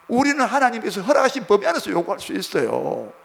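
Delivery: ordinary delivery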